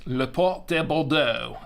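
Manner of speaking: Port de Bordeaux in a hammed-up French accent, lə pɔt ɛ bɔldoʊ; playful fake French accent on the wine's name. Shown as a demonstration. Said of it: "That's the French accent".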